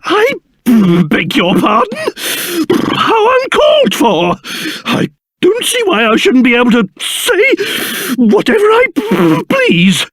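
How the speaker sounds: spluttering, affronted outrage with shivery stutters; livid. Acted. King scolding a boy for insulting him, livid and spluttering. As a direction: splutter with pompous indignation. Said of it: "Livid, I tell you".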